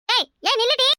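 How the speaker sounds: high call to stop; mother calling after her daughter. Acted. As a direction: yell at her to stop as she runs. Mother yells after running daughter.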